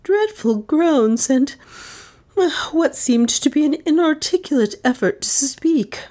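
high, faltering horrified voice; shaken, high-pitched account. A narrator's reading. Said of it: "Miss Cornelia's voice shook".

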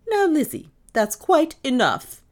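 high, loud, deliberate reprimand; imperious. A narrator's reading. Mistress silencing her maid, imperious. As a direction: shut the subject down grandly. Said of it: "had the Van Gorder manner on".

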